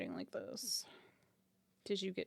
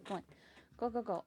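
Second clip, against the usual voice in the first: usual speaking voice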